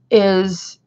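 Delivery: usual speaking voice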